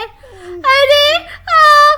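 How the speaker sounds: high, loud startled scream; a sudden terrified shriek. Acted. Child screaming as the girl turns into a ghost.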